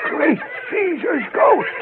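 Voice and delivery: slow, hushed astonishment; awed gasp. Acted. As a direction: breathe it out in disbelief. Amazed at little men.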